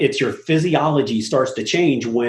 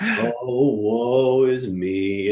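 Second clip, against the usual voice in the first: Eeyore voice; 2 semitones lower, much slower than usual. Retold or imitated. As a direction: sigh it out like gloomy Eeyore. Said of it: "Some people are Eeyore".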